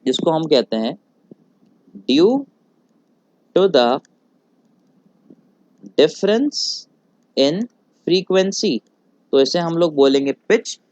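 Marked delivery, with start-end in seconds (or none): 2.1-8.8 due, to the, difference, in, frequency, each with a long pause; slow dictation. Shown as a demonstration. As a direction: dictate it phrase by phrase for note-taking. Teacher dictating definition slowly.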